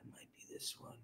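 barely audible, unvoiced mutter; whispering to self. Real, not acted. Streamer hunting for the right clip, whispering to self. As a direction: whisper it absently under your breath.

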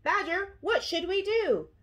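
high-pitched worried appeal; gasping worry. A narrator's reading. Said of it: "Hedgehog gasped".

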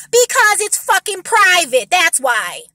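real anger, spelled out; angry emphasis. Real, not acted. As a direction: hit it hard and slow. Angry answer to mocked objection.

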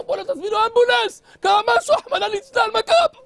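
high, loud frantic cries; panicked alarm. Acted. Young Kahaneman panicking outside the house, high and loud. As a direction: call for help in a panic.

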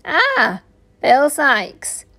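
high, oily drawled greeting; drawling. A narrator's reading.

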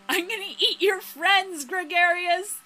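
loud, high-pitched playful menace; mock threat. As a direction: shout a hammed-up threat.

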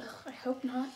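unvoiced whisper. Real, not acted. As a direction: breathe it without voice. Whispered aside.

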